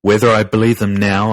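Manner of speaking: plain reading voice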